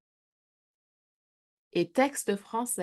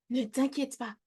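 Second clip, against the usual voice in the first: softer, calming murmur; hushed reassurance; 5 semitones higher, 5 dB quieter than usual. A narrator's reading. Papa reassuring maman in the kitchen, speaking softly. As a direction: hush your wife gently. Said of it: "chuchote papa Tire-Bouchon".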